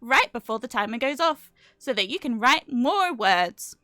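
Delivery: loud, squawky bouncy penguin. Pretend. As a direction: read it like a cheerful cartoon penguin. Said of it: "Should I read it in my penguin voice?".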